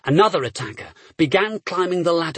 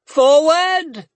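much higher and louder strained yell; 11 semitones higher, 7 dB louder than usual. A narrator's reading. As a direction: yell until the voice cracks. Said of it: "he yelled, his voice cracking with the strain".

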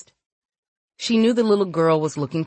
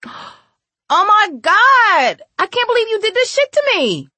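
screamed outrage; 6 dB louder than usual. A narrator's reading. Girl screams at Naylor, furious high shriek. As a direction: scream it at the top of your pitch. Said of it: "she screamed at Naylor".

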